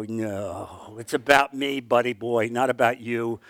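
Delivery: God's voice. Retold or imitated. Voicing God, lower.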